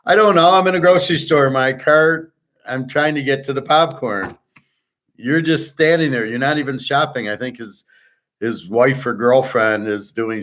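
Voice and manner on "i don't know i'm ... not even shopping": higher-pitched, exasperated self-defence; indignant retort. Retold or imitated. Shopper arguing back at man blocking popcorn, indignant. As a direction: argue back in a raised pitch. Retold argument with man in store.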